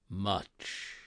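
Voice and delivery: low, quiet ghostly reply; unearthly voice. A narrator's reading. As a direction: murmur it like a ghost from the grave. Ghost answers Scrooge.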